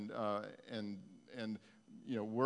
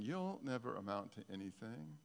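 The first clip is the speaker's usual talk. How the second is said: soft, low voice from memory; voice from the past; 4 semitones lower, 6 dB quieter than usual. Acted. Preacher quoting a voice from the past, low and subdued. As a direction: quietly voice an old put-down. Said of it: "You hear the voice from the past saying".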